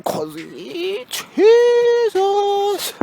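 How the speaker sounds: slow, rising to a high stretched "Jesus"; mock dramatic high wail; silly drawn-out voice. Joking to viewers about avoiding the route.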